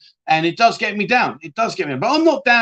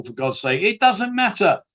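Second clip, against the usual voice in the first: ordinary delivery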